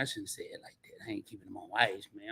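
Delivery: breathy whisper to himself; whispered self-correction; whispered aside. Real, not acted. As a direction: mutter the correction under your breath.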